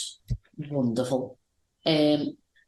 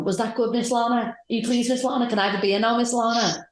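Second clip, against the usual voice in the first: higher, sweet servile asking; higher, fawning servant voice; higher eager-to-please voice; 7 semitones higher, faster than usual. Retold or imitated. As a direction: suck up to her for a beer.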